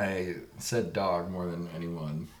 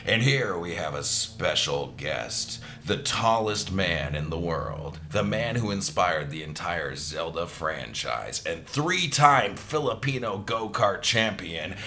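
grand announcer intro; slower than usual. Acted. Slow grand guest intro.

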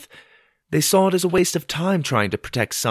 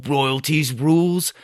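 slow, higher, scornful parroting; mocking echo; 5 semitones higher, 3 dB louder, slower than usual. A narrator's reading. Mother mocks father's rule at the table, scornfully. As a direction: drag it out as a sneer.

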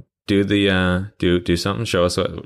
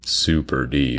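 lowered pretend-deep voice; put-on deep voice; 4 semitones lower, slower than usual. Reprising the voice that scarred co-host, deep voice. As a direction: drop right down for effect. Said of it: "you doing that deep voice".

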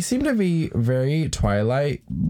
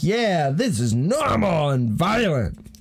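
slow, higher-pitched mock coach; gung-ho coach; 5 semitones higher, slower than usual. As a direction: draw it out like an approving coach. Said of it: "the coach is like".

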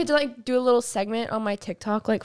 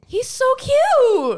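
much higher, louder, slow gushing squeal; 14 semitones higher, 6 dB louder, much slower than usual. Real, not acted. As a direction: swoon over a crush. Gushing over cute quarterback.

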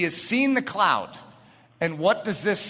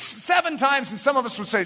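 ordinary talk, nothing marked

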